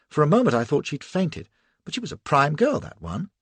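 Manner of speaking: ordinary delivery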